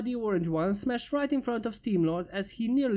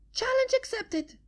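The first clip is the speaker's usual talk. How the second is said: very high gleeful acceptance; cheeky Pinky. Acted. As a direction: squeak it triumphantly.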